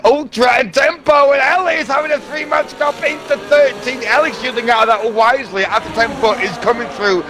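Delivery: excited live race call; motorsport commentator. Acted. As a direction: call the overtake rising fast. Live play-by-play build.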